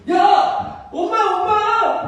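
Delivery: high, loud, drawn-out acted cry; calling out. Mother spots her long-lost child, calling out.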